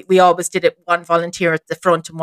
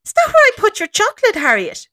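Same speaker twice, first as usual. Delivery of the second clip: very high, cooing mock innocence; singsong, put-on discovery; feigned surprise. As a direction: play innocent to a little child. Feigned surprise to child spirit.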